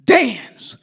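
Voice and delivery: big booming shout of dance; shouted command. Retold or imitated. Conductor shouting at the chorus to dance. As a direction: bellow it to get them up. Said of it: "And he says".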